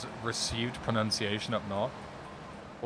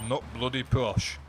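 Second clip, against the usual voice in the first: mock Yorkshire accent. Mocks claim Yorkshire accents are posh.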